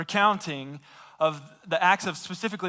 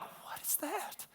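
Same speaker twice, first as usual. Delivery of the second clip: astonished whisper; 11 semitones higher, 16 dB quieter, much slower than usual. Retold or imitated. Germans gape at runner.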